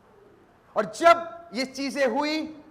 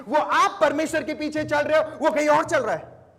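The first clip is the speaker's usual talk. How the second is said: louder, punchy sermon build; fervent preaching; 5 semitones higher, 6 dB louder than usual. Acted. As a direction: warn the congregation with rising force. Preaching contrast.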